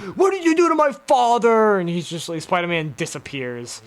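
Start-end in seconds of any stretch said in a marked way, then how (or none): 0.0-1.8 angry accusation. Retold or imitated. Voicing Harry's accusation.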